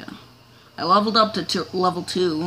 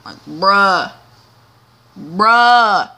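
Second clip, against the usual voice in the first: louder, falling bruh of disbelief; exasperated bruh; 9 dB louder, much slower than usual. Real, not acted. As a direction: groan bruh at the screen. Exasperated at game.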